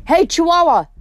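high, loud taunting yell. Acted. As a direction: shout it across the deck to the monster. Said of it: "and yelled".

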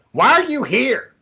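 high-pitched mock rant; going off on someone. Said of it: "she'll fucking go off on her people".

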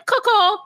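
high, odd-vowelled Coco; Jasmine. Retold or imitated. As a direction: say "Coco" high, between "cuck" and "co". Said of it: "the way Jasmine says Coco".

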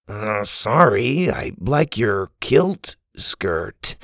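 slow, halting apology; sheepish correction. Acted. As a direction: correct yourself awkwardly and slowly. Boy awkwardly corrects himself.

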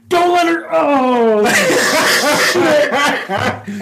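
high mock-panic shout, dissolving into laughter; retold alarm. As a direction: blurt a horrified warning, then crack up. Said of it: "I go like this, like".

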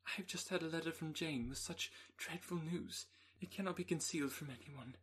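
high, trembling, on the edge of tears; distressed young woman. Acted. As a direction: pour out the terrible news, near breaking. Elizabeth tells Darcy dreadful news.

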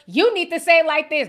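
correcting critic. Retold or imitated. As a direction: lecture her on pronouncing. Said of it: "They're fixing my pronunciations".

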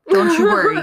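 high, loud cry of don't you worry; mock reassurance. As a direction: call out to soothe him. Consoling Gerard Butler, mock-tender.

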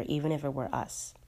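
ordinary delivery

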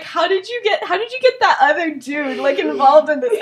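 high, loud, amazed questioning; mock incredulity. Remus asks how they recruited him, incredulous. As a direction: imitate a friend who can't believe it.

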